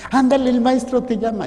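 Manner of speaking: high, loud, urging him on; eager call. Acted. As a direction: hurry him along excitedly. People calling the blind man.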